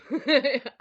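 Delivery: a sudden loud burst of real laughter. Real, not acted. Mom bursting into real laughter at her son's reply. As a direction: crack up mid-quote.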